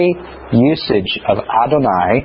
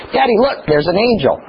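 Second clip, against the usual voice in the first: put-on higher, a little kid pointing something out; light toddler voice; child's voice; 6 semitones higher than usual. Father retelling his son's words in a light kid voice. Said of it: "he goes".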